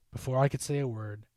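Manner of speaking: ordinary delivery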